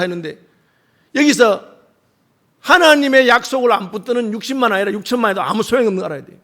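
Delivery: normal talking voice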